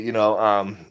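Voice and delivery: usual speaking voice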